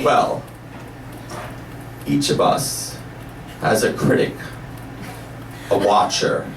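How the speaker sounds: slow, low spiritual teacher; low voice with long pauses between phrases; Mister Nepo. Retold or imitated. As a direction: answer slowly, like sharing deep wisdom. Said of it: "slowly starts answering it in a spiritual way".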